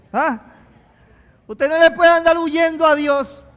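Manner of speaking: full-force pulpit cry; fiery preaching. Acted. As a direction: drive it home to the congregation.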